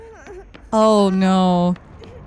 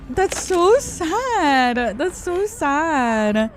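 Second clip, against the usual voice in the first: sad; 7 semitones higher, much slower than usual. Real, not acted. Sentimental about kids growing up.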